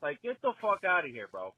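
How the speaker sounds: usual speaking voice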